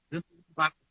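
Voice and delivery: muffled, as if talking inside a glass; into a cup. Shown as a demonstration. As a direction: speak the words into a cup. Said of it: "pareciera que alguien está hablando dentro de un vaso".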